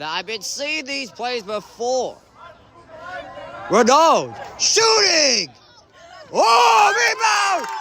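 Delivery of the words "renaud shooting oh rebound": slow, then high, loud yell on rebound; shouting the play. Real, not acted. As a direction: build the call into a shout. Live call of a shot.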